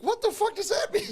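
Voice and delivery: high, laughing mock confusion; imitating a friend laughing at nonsense; baffled laugh. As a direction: squeal it through laughter in disbelief.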